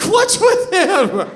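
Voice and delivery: high, slow, laughing mimic of the wife; mock wife voice. Wife mocking him, high and slow with a laugh. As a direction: play the wife sneering at him. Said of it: "She's like".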